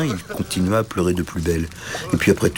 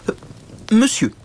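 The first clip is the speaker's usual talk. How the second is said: higher, hesitant but dignified; dignified little knight; 13 semitones higher, 3 dB louder than usual. Acted. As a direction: try to stay dignified while dangling. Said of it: "dit le petit chevalier aussi dignement que possible". (petit chevalier digne, voix plus aiguë)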